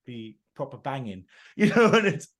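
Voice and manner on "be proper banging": put-on London-style Southern chatter; exaggerated Southern English vowels; Southern English accent. Shown as a demonstration. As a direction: talk like a cheeky Southern English geezer. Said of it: "when I try to talk, like, Southern".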